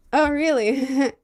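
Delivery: fake interest. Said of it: "I'm like".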